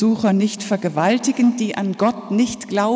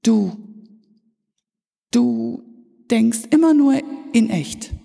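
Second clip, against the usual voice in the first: thoughtful, halting little reply, slightly raised pitch; thoughtful child's reply; 3 semitones higher than usual. A narrator's reading. Granddaughter answers mother at tidy-up time, thoughtful. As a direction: answer pensively, pausing after the first word, without aggression. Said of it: "nicht aggressiv, sondern nachdenklich".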